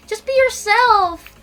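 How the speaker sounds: high, drawn-out parent imitation; slow, lilting mock encouragement; urging parents. Parents urge their child to relax, mock coaxing.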